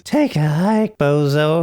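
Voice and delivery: high squeaky mock brush-off; broken adolescent voice. Host mimics young John dismissing a passerby, broken voice. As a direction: squeak it like a cocky teen. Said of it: "His voice is so broken".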